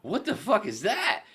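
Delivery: high, slow, laughing disbelief; baffled disbelief. Retold or imitated. Retelling his reaction to the puppy promo, incredulous.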